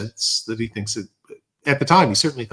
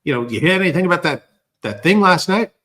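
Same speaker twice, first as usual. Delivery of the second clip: higher-pitched imitated question; Lori Wickens; 6 semitones higher than usual. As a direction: ask it eagerly as the local witness. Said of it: "and he said".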